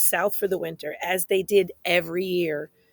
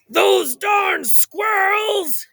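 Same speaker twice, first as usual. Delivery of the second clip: put-on high, slow cranky old-man shout; grumpy old man yell; 11 semitones higher, 5 dB louder, much slower than usual. Old man shouting at the squirrels, high cranky yell. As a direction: yell it like a grump shaking his fist. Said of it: "yelled Old Man Fookwire".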